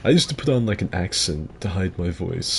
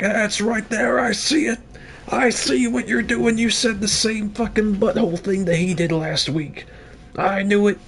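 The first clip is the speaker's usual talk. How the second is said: high, mock-outraged gotcha voice; accusing commenter; 13 semitones higher than usual. Mocking a viewer who spots a copied joke, high and outraged. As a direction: squeal like a smug fan catching him out. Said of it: "It's like".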